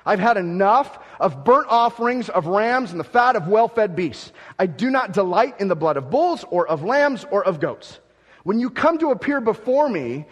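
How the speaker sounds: loud, declaiming Isaiah as God's complaint; forceful scripture reading. Acted. Preacher reading God's rebuke to the people, loud and declaiming. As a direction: proclaim it like a preacher at full force.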